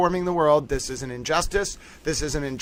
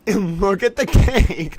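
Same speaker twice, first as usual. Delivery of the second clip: laughing; 4 semitones higher, 4 dB louder, much slower than usual. Real, not acted. Laughing at the cake image.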